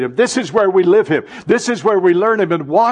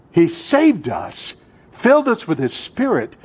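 usual speaking voice

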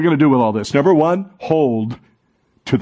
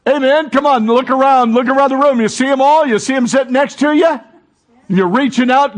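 raised, urgent preaching to the congregation; fervent preaching; 10 semitones higher, 3 dB louder than usual. Real, not acted. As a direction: lift the room with hope. Pastor urging congregation, full force.

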